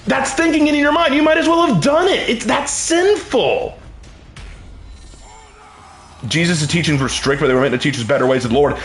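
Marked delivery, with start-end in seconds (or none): 0.1-3.8 high-pitched, impassioned sermon; emphatic preaching. Real, not acted. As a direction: drive the point home like a preacher.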